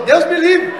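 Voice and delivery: loud, high exclamation; loud acted dread; mock horrified recoil. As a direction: exclaim it like warding off doom. Kid recoiling at the injection.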